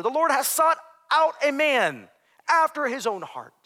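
loud, high scripture proclamation; full-force preaching. Acted. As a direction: thunder the verse out to the congregation.

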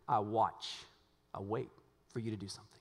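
hushed. Acted. Quoting David's expectant prayer, hushed.

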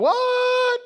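high, loud, stretched-out outcry; shocked disbelief. Acted. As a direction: yell it in mock astonishment. Everyone reacting in disbelief.

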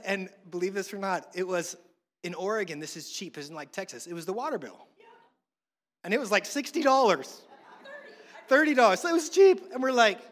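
ordinary delivery

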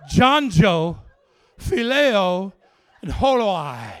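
very loud, spaced-out proclamation; booming names. Retold or imitated. Preacher reveals the dogs' names, booming proclamation. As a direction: boom each name out on its own.

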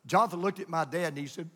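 ordinary talk, nothing marked

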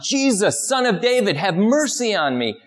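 high, pleading cry for mercy. Retold or imitated. Blind beggar crying out to Jesus from the street, high and pleading. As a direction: call out high and desperate over the crowd. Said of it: "he starts crying out".

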